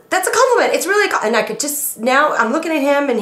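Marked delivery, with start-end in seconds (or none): 0.1-0.8 high, louder backpedaling husband; husband insisting it's a compliment, much higher; flustered husband. Retold or imitated. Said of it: "He said". 0.8-1.2 high, louder backpedaling husband; husband insisting it's a compliment, much higher; flustered husband. Retold or imitated. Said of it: "He said".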